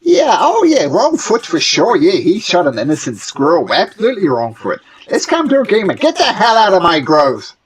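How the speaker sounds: high gnome voice dripping sarcasm; sarcastic gnome. Acted. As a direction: gnome mocking then throwing them out.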